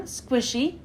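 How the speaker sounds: ordinary delivery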